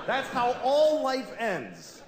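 ordinary talk

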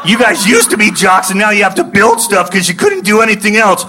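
high shrieking laughing rant, stretched out; nerd rage screaming. Retold or imitated. Comic reenacting shrieking at construction workers in nerd rage. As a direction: yell it like an unhinged nerd. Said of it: "And I just go".

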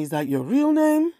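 a customer asking in disbelief, mocked high. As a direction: mimic a doubting customer, pitched well up. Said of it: "after they ask me".

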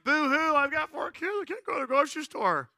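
high-pitched pretend boo-hooing; mock self-pity. Parent dreading the grocery store, mock wailing. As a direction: put on a hammed-up whine.

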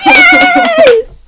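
high, loud laughing cheer; gleeful shout. Real, not acted. Game master overrules the players' noes, gleeful shout. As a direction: yell it in triumph while laughing.

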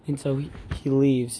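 ordinary talk, nothing marked

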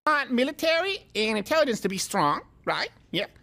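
exaggerated mock Chinese accent, laughing at the end. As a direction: lift the pitch and lay on a crude Chinese accent. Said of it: "in a racist Chinese voice".

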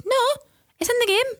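very high mock-child voice; high kid's protest. Mum voices her son protesting.

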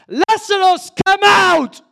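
huge high-pitched shout; full-force preacher's cry; shouted command. Acted. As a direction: bellow it like summoning the dead. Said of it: "and then he shouts".

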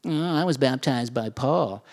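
mock a bragging church member; boastful Corinthians. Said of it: "the Corinthians are like".

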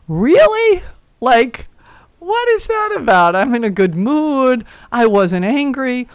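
very high, mock-baffled questioning; hammed-up high-pitched puzzlement; incredulous curiosity. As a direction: squeak in playful disbelief at yourself. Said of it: "And I got curious".

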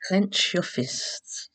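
measured relaxation instructions with long pauses; guided meditation. Acted.

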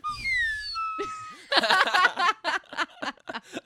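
shrieking laugh; high crying laugh. Real, not acted. Laughs over fake crying audience.

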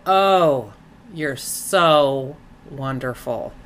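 slow, drawn-out gushing compliment; slow, low, laid-on admiration; demonstrative praise. Shown as a demonstration. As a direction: stretch out the praise to show off the inflection. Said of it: "How about this?".

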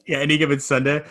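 ordinary talk, nothing marked

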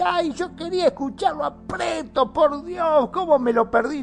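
high, whiny fan who missed the show; excited listener voice. Retold or imitated. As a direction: squeal it like a disappointed fan.

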